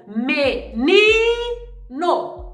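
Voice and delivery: MENINO with the NI held very long; lingering stressed syllable. Shown as a demonstration. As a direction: stretch out the middle syllable of MENINO, slowly. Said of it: "Onde eu demorei".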